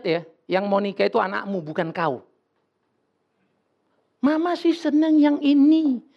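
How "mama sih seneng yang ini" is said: a slow, higher, louder mother's voice; doting mother. Retold or imitated. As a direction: play the pushy mother who likes this one. Mother voiced choosing son's match. (suara ibu yang memaksakan pilihan, pelan dan tinggi)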